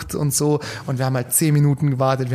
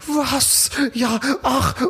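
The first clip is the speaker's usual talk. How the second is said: much higher, startled gasp of surprise; perplexed; 8 semitones higher, much slower than usual. Retold or imitated. The woman getting her money back, high startled mock surprise. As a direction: mimic her utter bafflement, pitched way up.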